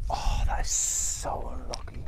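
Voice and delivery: slow, drawn-out whisper; hushed commiseration. Real, not acted. Golfer reacts to a near miss, hushed whisper. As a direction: whisper it slowly in sympathy.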